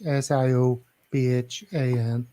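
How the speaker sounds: ordinary talk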